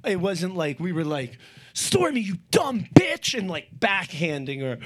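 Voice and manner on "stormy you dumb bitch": higher, louder pretend scold; mock harsh owner. Pretend abusive owner yelling at the dog, mock harsh. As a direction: play the cruel owner they are not.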